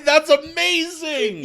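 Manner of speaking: high, loud, drawn-out exclamation; amazed delight. Real, not acted. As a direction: burst out laughing in real amazement. Delighted at the name coincidence.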